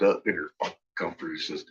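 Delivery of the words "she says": ordinary talk, nothing marked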